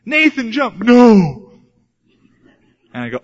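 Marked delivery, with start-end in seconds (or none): 0.0-0.8 high, coaxing call to his son; coaxing dad. Retold or imitated. Father in the pool urges his son Nathan, high. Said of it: "I'm teaching to swim". 0.8-1.6 put-on loud, high child's no; scared boy refusing. Son Nathan refuses to jump, loud and high. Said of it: "teaching to swim".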